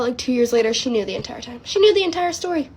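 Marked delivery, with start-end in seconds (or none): 1.6-2.7 high-pitched, incredulous repeat; emphatic disbelief. Real, not acted. Teller reveals grandma knew, emphatic disbelief. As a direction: hit the reveal much higher.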